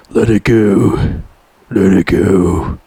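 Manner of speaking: slow low menacing quote. Retold or imitated. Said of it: "Rambo told him".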